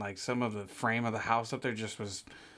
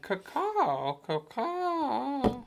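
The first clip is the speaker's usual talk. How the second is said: high caw, higher at the end; high bird caw; 11 semitones higher, much slower than usual. Retold or imitated. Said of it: "it's more up high".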